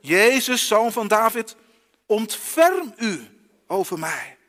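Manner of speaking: loud plea, slowing on the last words; calling out. Acted. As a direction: cry out for mercy. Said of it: "Hij roept".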